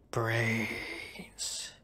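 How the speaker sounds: unvoiced whisper, like a zombie. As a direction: breathe it out as a zombie. Zombie moan, then question.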